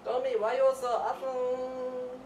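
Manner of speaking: put-on high, loud gushing baby talk; loud high coo. Owner loudly gushing over the cat Domi.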